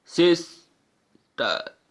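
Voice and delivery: Sister very slow, long gap before टर; syllable by syllable. Shown as a demonstration. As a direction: make sister two clearly separate sounds. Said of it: "टू बार effort करना पड़ रहा है". (Sister में लंबा विराम देकर)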